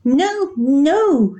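high, loud desperate no; screaming. A narrator's reading. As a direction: scream in panic. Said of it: "she screamed".

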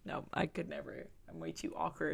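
usual speaking voice

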